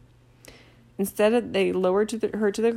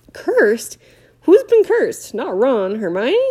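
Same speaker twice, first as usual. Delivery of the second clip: much higher and louder, alarmed; alarmed shout; 4 semitones higher, 9 dB louder, slower than usual. Acted. Hagrid to Harry, an alarmed shout.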